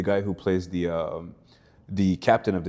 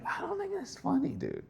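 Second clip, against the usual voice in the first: high, softer mock sulk; mock grumble; 6 semitones higher, 6 dB quieter than usual. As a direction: grumble it to yourself, lightly hammed-up. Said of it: "And I'm like".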